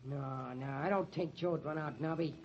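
low, soft, hesitant; subdued, troubled mumbling; downcast and unsure. Acted. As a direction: admit your doubts reluctantly. Newsboy drops his cry, troubled by rumour.

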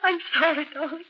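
upset wife pleading with her husband; frightened pleading. Acted. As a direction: beg him to forgive you. Pleading after a fight.

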